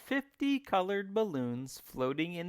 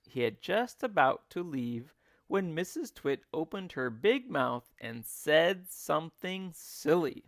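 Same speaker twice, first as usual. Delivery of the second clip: ordinary delivery, nothing marked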